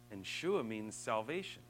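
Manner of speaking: ordinary talk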